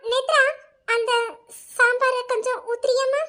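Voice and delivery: a sweet command that is really a put-down; sarcastic sweet order. Acted. Aunt orders Nethra to pour sambar in loud sugary sarcasm.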